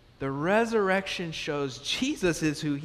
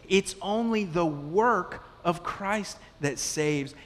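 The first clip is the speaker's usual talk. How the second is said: slow, raised emphatic preaching; 4 semitones higher, slower than usual. Acted. Pastor pressing the point home to the congregation, slow and emphatic. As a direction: lean into each phrase, slowly and higher.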